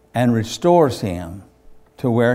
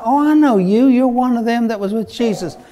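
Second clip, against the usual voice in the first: put-on girl's accusation; 10 semitones higher, 4 dB louder than usual. Servant girl accuses Peter.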